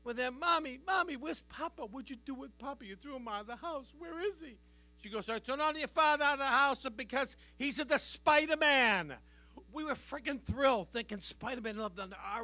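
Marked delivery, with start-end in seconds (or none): 0.4-4.6 put-on little boy. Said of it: "me and my brother were five and six". 5.3-9.2 mock Italian accent, garbled English; mother's Italian accent. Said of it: "My mother has an accent".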